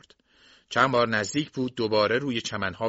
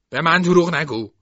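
loud, raised-pitch warning; angry accusation; 5 semitones higher, 8 dB louder than usual. A narrator's reading. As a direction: throw the accusation out forcefully before lunging. Enraged accusation before attacking. (هشدار خشمگین و بلند)

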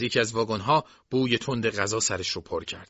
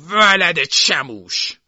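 loud, drawn-out, high insult; angry curse; 6 semitones higher, 10 dB louder, much slower than usual. A narrator's reading. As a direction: stretch the curse out while struggling in a grip. Old man cursing while grabbed. (ناسزای کشیده و بلند)

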